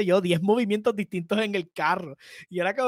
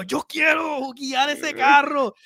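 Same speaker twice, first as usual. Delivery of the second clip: excited shout; 7 semitones higher, much slower than usual. Retold or imitated. Kid wanting to drive the car.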